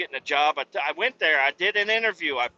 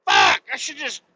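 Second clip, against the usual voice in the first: shouted, much higher and louder, genuinely pissed off; real furious rant; 11 semitones higher, 6 dB louder than usual. Real, not acted. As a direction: yell it out, truly fed up with the calls. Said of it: "I'm so pissed".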